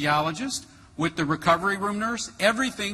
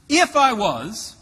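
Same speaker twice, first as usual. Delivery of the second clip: drawn-out, louder and higher insistence; insistent repeat; 6 semitones higher, 7 dB louder, slower than usual. Retold or imitated. Patient pressing the scheduling nurse to choose, insistent. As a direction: press the point slowly and loudly.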